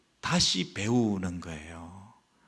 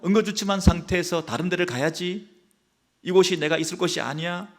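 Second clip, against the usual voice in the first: mock protest; 6 semitones higher than usual. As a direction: whine like someone insulted by their lot. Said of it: "우리는 이렇게 막 항변하면서". (높고 큰 소리로 억울하게 항변하듯)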